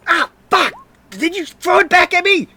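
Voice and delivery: high-pitched, loud outraged shriek; shocked yell. Acted. Gunman hit by his own returned bullet, shocked high yell. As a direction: yell it in a squawking high character voice. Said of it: "as he just yells".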